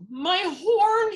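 high, loud wail of shock; acted horrified outburst; gulped cry of dismay. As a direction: cry out aghast. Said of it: "he gulped".

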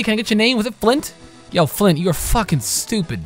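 ordinary talk

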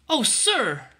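put-on high, loud girlish plea; crying out. A narrator's reading. Ellen clinging to her bundle, high pleading cry. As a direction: cry out in a child's protest. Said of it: "she cried as she clung".

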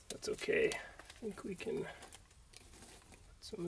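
under-the-breath whisper to himself; a quiet whispered mutter; whispered aside. Real, not acted. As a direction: whisper it while tending the fire. Muttering to himself over fire.

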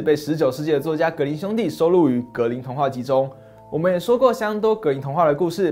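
ordinary delivery

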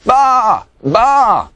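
two loud, high bleats; sheep bleating. A narrator's reading. Pete the sheep answering the shearers, bleating. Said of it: "said Pete".

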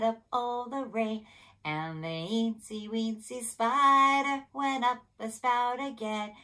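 slow, soft little eensy weensy voice; tiny spider version of the song. Shown as a demonstration. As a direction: sing it small and slow. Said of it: "Let's do it like this".

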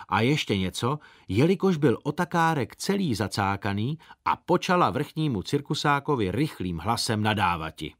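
ordinary delivery, nothing marked

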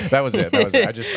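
high, loud laughing reply. Real, not acted. Host answering the co-host's teasing, laughing high and loud. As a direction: squeal it out through laughter. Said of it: "laughing reply".

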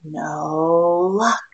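ordinary delivery, nothing marked

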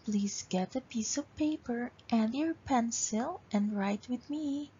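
ordinary talk, nothing marked

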